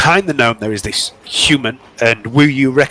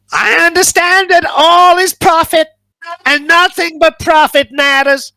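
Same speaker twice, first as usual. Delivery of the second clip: greedy gnome; 13 semitones higher than usual. Acted. Gnome explaining profit to customers, high and greedy. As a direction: squeal it out as a gold-obsessed little gnome. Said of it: "the little gnome says".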